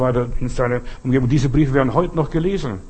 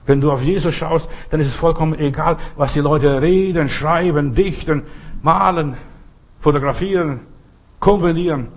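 raised, punchy pulpit list; higher, emphatic preacher's delivery; preaching; 4 semitones higher than usual. Real, not acted. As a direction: hammer each item home to the congregation.

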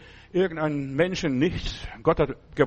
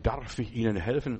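polite offer; 6 semitones lower, 5 dB quieter than usual. Retold or imitated. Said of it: "dann frage ich".

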